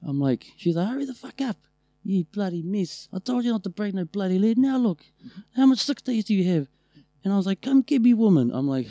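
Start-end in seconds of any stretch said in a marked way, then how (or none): 0.9-6.7 high-pitched scolding from the porch; mock furious wife hollering; imitated yelling. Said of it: "she was yelling at me from the porch". 7.6-8.5 high, loud retold holler; mock indignant yell across the driveway; calling out. Calling back to wife from outside.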